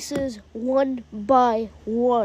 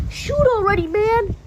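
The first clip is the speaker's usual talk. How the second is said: high-pitched impatient urging; urgent urging; 6 semitones higher than usual. A narrator's reading. Morgan to hesitant Shane, impatient high-pitched urging. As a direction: push a hesitating friend to act.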